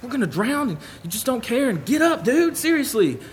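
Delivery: high, anxious pleading; mock panic, begging him to wake up; frantic high-pitched complaint to a sleeping friend; panicked disciples. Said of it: "and they're like".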